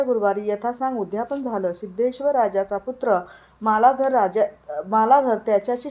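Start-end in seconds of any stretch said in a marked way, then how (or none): none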